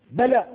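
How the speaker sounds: بلى shot up high and loud; emphatic answer. Shown as a demonstration. Teacher answering the verse's question for the class, high and loud. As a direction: punch out بلى as the decisive answer.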